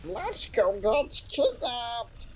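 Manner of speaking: squeaky high sing-song voice; a cartoonish squeaky thank-you; high cutesy voice. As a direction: chirp it up high and sweet to chat.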